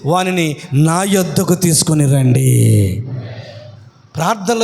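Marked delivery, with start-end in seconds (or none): 0.1-3.0 low, slow, calm command; Jesus commanding. Acted. Preacher voicing Jesus calling for the blind man, low and slow. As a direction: speak it as a lord halting the crowd.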